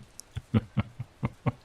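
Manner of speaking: long, airy chuckle; breaking into chuckles. Acted. Dragon amused at needing a human, drawn-out chuckle. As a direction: chuckle on in little bursts. Dragon laughs at own remark.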